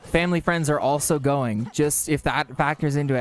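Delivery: normal talking voice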